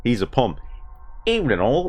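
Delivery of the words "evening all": much higher, broad Australian greeting; hammed Australian accent. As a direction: greet like a bloke from the outback, hammed up. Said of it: "I don't know how to do a Pommy accent".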